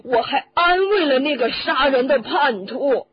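high, loud roar of anguish; sudden roar. Acted. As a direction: bellow it out in furious regret. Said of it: "突然咆哮起来". (突然咆哮)

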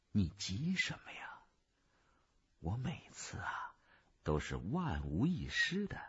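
压低嗓音，轻声安抚; 轻而低地私下嘀咕; 低声说话. A narrator's reading. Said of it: "福天儿低声地对我说". (凑近了小声说)

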